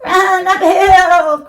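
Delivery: high, slow bleating sheep; a high bleating cry of a sheep. A narrator's reading. Sheep calling a warning to Wilbur, high and slow. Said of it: "cried the sheep".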